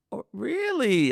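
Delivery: high, slow mock surprise; to his cousin, mock-surprised and sarcastic; sarcastic disbelief. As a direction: drip with sarcasm.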